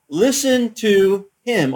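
loud, high, very slow command; voice over a loudspeaker. Retold or imitated. God's voice from heaven commanding the disciples, loud slow proclamation. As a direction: boom it out, stretching every word. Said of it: "over the loudspeaker said".

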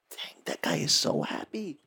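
soft, low under-the-breath amazement; hushed envious thought. Retold or imitated. The preacher envying Tommy, hushed and low. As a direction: say it to yourself in wonder.

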